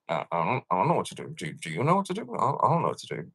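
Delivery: quiet, fumbling, clueless official; mock clueless bureaucrat, mumbled softly; mock helpless mumble. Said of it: "they're just like".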